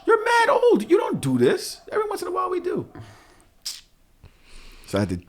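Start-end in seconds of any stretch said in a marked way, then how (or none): 0.0-2.9 much higher, laughing incredulity; incredulous outburst. Retold or imitated. Dad shocked the kids wet the bed, incredulous. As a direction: squeal at the kids in disbelief. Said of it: "I was like".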